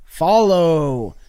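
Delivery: loud, drawn-out order; booming command. Acted. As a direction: call it out long and loud like a monster's command. Translating Grimace's cry as a command.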